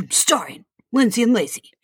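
movie trailer voice. Pretend. Mock movie trailer narration.

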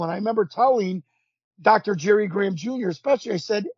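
normal talking voice

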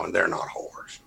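whispered, soft and pointed; hushed insistence. Real, not acted. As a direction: drop to a near whisper to drive it home. Defending daughters.

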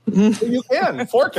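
high, loud playful jab. Real, not acted. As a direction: toss it out high and cheeky. Joking about the pun.

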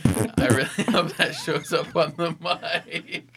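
slow, loud words through laughter; laughing. Real, not acted. Player hoping the mic caught it, laughing. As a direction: fight real laughter to finish. Cracking up at the table.

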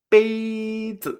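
PEI long, high and level, ZI short and light; tone one, toneless. Shown as a demonstration. Teacher gives the word for glass, level then neutral tone. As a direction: stretch "pei", drop a quick "zi". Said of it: "tono uno y luego el sin tono".

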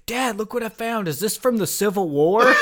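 put-on high, excited child's voice calling out; kid voice. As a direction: play the little boy yelling to his dad. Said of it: "came up to his dad and said".

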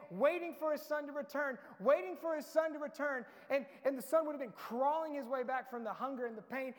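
loud, high, driving sermon voice; fiery preaching. Acted. Pastor urging his congregation about the waiting father, loud and fervent. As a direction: preach it out with passion to the crowd.

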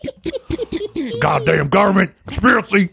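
high, slow, strained crank voice; mock conspiracy rant. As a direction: cry it out as a hammed-up paranoid. Mock outburst after failed throat clearing.

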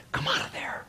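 whispered call, soft and unvoiced. The Holy Spirit calling you out of hiding, whispered. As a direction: coax softly in a whisper.

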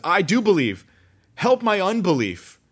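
high, loud pleading cry; father crying out to Jesus for help; desperate cry. Acted.

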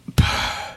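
weary sigh; a heavy, fed-up sigh; exasperated sigh. Acted. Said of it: "I'm doing both parts".

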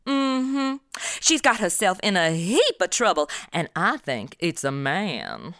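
mock southern drawl creeping in; southern accent. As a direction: slip into a put-on southern accent. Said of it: "I always start talking like 'em".

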